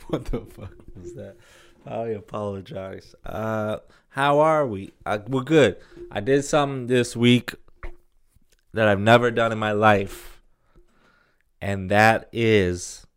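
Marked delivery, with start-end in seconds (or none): none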